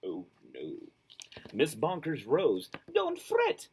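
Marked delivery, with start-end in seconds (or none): 1.5-2.7 lively storybook read-aloud; reading to children. A narrator's reading. Reads picture book aloud. 2.9-3.7 reassuring. Acted. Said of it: "she said".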